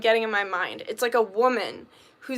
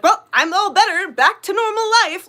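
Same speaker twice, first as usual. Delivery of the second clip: much higher and louder, falsely chipper; mock cheerful; 8 semitones higher, 7 dB louder than usual. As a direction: parody someone who thinks grief is over. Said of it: "then you're like".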